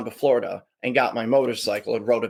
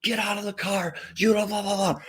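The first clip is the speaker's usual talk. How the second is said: higher, mock angry cop shouting orders; imitated yelling cop; 6 semitones higher than usual. Cop yelling at the driver who cut in, mock angry. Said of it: "yelling at me".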